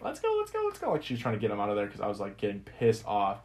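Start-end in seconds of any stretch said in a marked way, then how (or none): 0.0-0.9 much higher, hurried girlfriend impression. Girlfriend pulling drunk boyfriend out of the room, high hurried voice. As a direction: chirp it like she's herding him out.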